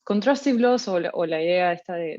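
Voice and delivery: usual speaking voice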